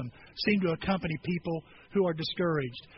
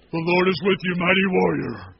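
louder, solemn announcement; angel's greeting; 3 semitones lower, 6 dB louder than usual. Retold or imitated. Angel greeting Gideon, lightly voiced solemn herald. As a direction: proclaim it like a herald. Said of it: "appears and says".

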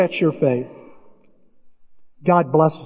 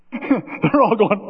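ordinary talk

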